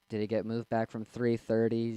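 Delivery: ordinary talk, nothing marked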